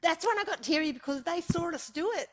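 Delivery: welling up while telling it; choked up with emotion at the memory; getting teary. Real, not acted. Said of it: "I'm getting teary again".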